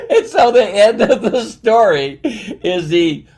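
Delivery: high, loud laughing speech; real laugh still in the voice; laughing while talking. Real, not acted. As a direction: push the words out through laughter. Still laughing after the punchline.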